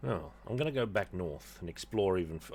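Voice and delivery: ordinary delivery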